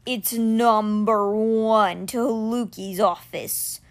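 very slow, grave stone cat; slow and low like a stone beast. Acted. Stone cat telling Alex the way, low and slow. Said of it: "Simber said in a low voice".

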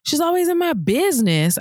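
put-on put-upon child griping; indignant son. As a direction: play the son complaining about his nosy teacher. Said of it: "And he's just like".

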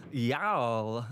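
lightly voiced mash-up of two celebrity impressions; Christopher Walken doing Arnold Schwarzenegger. Trying a layered impression for the co-host, Walken as Schwarzenegger. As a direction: draw it out as a comic double impression. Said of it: "Christopher Walken doing Arnold Schwarzenegger".

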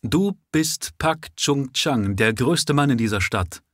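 slow accusing tone. A narrator's reading. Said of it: "in einem Ton, der schon eine Anklage war".

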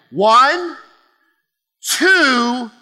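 rally the room on each number; booming countdown to a crowd shout; loud countdown. Real, not acted.

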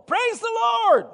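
high, strained shout; piercingly high preacher's command; eyeball-to-eyeball demand. Retold or imitated. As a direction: press her hard, face to face. Looking right in her eyeball to eyeball.

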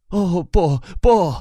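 更高更响的连声否认; 赶紧拦住他的话头; 急忙打断. Acted. 母亲急忙打断. (母亲急切地连声说不)

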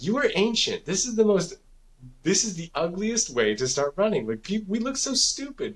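high, teasing complaint; mocking whine. Son mocks dad's slow jog.

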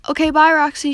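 loud call across a crowd; shouted goodbye; yelling goodbye. A narrator's reading. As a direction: call it out over the noise. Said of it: "she yells".

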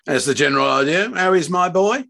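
loud, rising impatient retort; angry demand. A narrator's reading. Father demanding news of his child from surgeon, angry. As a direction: push the doctor for an answer.

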